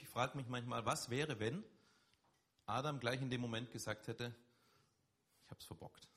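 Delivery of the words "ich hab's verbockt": whispered confession. Acted. Adam admitting his failure.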